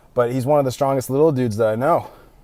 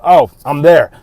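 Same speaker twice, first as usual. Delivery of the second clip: quick, louder, keen answer; eager reply; 2 semitones higher, 9 dB louder, slower than usual. Retold or imitated. Buddy answering a call to the gym, louder and eager. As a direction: jump at the chance to join.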